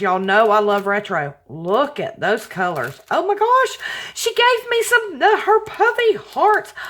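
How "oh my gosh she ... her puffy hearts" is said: much higher, louder delight; excited squeal. Real, not acted. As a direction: gush over the puffy hearts. Discovers puffy hearts.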